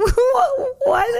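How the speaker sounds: high, loud, half-crying "what? why?"; panicked confusion as bikers roll up; shocked disbelief. Retold or imitated. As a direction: squeal it in alarmed disbelief. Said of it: "and I was like".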